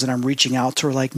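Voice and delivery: normal talking voice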